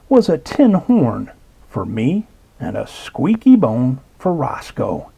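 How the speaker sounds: ordinary delivery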